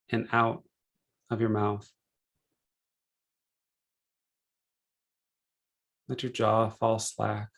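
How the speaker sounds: unhurried meditation guide, measured phrases with long pauses; calm, spacious relaxation-session delivery; guided meditation. Real, not acted.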